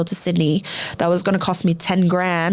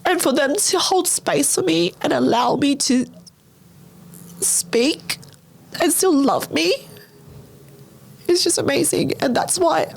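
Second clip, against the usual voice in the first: real choked-up voice, pitched much higher; emotional, near tears; 10 semitones higher than usual. Real, not acted. Telling friends' support after wanting to die, emotional. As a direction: fight back tears, pausing to get the words out. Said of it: "I'm getting emotional".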